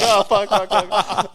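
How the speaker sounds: cursing through a laugh; laughing mock panic. Retelling the uncles' second launch, laughing panic. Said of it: "they're like".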